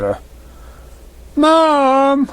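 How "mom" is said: high, loud filly call from another room; calling out for mom. Acted. Filly calling her mother from the kitchen, loud and high. Dinky calls.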